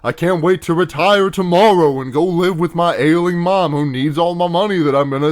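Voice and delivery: put-on naive, hopeful character voice; mock doomed character. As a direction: parody a cheesy line before certain death. Parodies a doomed character's hopeful speech.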